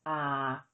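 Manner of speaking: ah low and level, Thai low tone; ah held low and slow. Shown as a demonstration. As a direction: sink ah to a low flat tone. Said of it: "the tone is low, as in".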